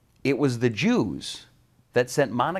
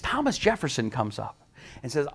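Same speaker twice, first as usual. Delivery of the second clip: normal talking voice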